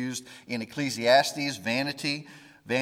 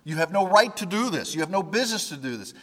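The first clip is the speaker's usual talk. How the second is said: higher, louder defiant protest, faster at the end; mock defiant outburst toward the Lord; defiant yell; 6 semitones higher, 4 dB louder, faster than usual. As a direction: shout it as a defiant complaint at God. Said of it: "yelling at the Lord".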